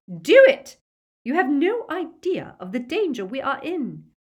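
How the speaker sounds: explosive, high, very loud outburst; shrieking. A narrator's reading. Bellatrix overrides her sister amid danger, furious shriek. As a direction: shriek the order, then rage on. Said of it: "shrieked Bellatrix".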